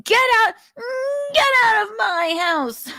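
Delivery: high, whiny mock yell; hammed-up high yell of get out. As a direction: imitate a man yelling from outside. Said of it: "Nick can be heard outside yelling".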